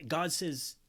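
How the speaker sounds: ordinary talk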